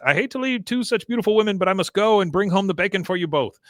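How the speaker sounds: breezy mock farewell; lightly voiced Khan.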